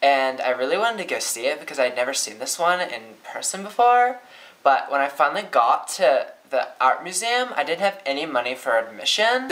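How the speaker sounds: rising pitch on "before" and phrase ends, as if asking; uptalk. Shown as a demonstration. Teacher opens with a demo story, uptalk. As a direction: end each phrase on a questioning rise. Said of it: "I was going up at the end".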